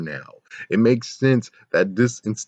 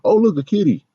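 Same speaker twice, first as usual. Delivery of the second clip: mocking clueless writers; 3 semitones higher, 4 dB louder than usual. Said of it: "than being like".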